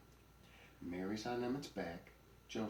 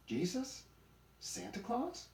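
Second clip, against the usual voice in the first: put-on high, rising kid's question; guessing child; slower than usual. A narrator's reading. Kids guessing at grandpa's story, high and eager.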